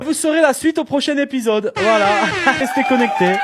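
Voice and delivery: high, loud cliffhanger sign-off; mock episode announcer. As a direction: tease the audience like a TV serial announcer.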